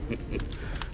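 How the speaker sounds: quiet mocking breathy laugh; mocking breathy chuckle; scornful chuckle. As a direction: sneer with a laugh. Commander mocks the singer.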